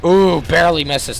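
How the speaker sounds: excited. Real, not acted. Near miss in the fight.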